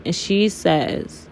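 ordinary delivery

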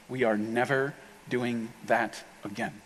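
slow, low and quiet finality; spaced-out words, softly final; grim verdict. Acted. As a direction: imitate a husband quietly laying down the law. Husband's grim verdict after church.

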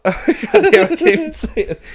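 ordinary talk

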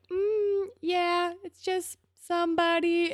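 high-pitched coy hedging; imitated shyness. Marco admitting a crush to Dylan, mock shy. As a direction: go high and bashful, dodging the question. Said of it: "he gets all shy".

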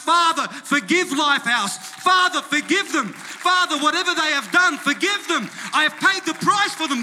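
high, loud impassioned plea; screaming out. Acted. Blood of Jesus pleading to the Father, screaming out. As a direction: cry out at full force, pleading. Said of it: "It's screaming out".

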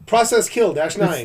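loud command. Acted. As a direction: yell the command out. Commander repeats shutdown order.

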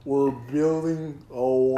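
put-on slow, drawn-out drunk uncle voice; like a tape at half speed; slowed-down Trump. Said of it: "Donald Trump played at half speed".

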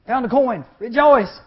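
2 high, loud jubilant cries; 3 shout it out like good news; 4 preacher whipping up the crowd with joy; 1 exultant shouts. Acted. Preacher exclaims rejoice refrain.

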